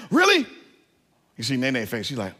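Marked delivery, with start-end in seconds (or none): 0.1-0.4 much higher, louder squeal of delight; excited squeal. Retold or imitated. Daughter hears they'll go back next year, squealing with delight. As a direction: squeal it like a thrilled little girl.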